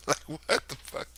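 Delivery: breathy whisper of disbelief. Real, not acted. Comedian whispering to the crowd in disbelief. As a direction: hush it like you can't believe it.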